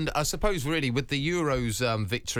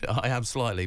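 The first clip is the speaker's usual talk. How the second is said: ordinary delivery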